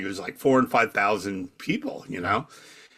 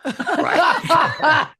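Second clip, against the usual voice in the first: ordinary talk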